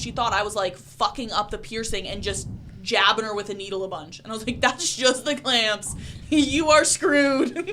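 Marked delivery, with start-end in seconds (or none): 4.6-7.7 very slow, louder, laughing through it; slow laughing punchline. Retold or imitated. Piercer tells the panicking client her fate, slow and laughing. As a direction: drag it out while cracking up.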